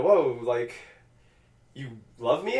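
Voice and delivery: ordinary talk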